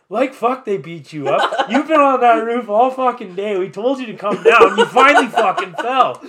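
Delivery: buddy scolding his friend, laughing. Retold or imitated. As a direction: scold the drunk buddy while cracking up. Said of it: "he's like".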